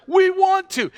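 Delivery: high, loud and eager; eager plea. Retold or imitated. Church members begging the pastor, high and loud with eagerness. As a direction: blurt it out, begging to give. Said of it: "They're like".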